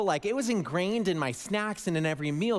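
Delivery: normal talking voice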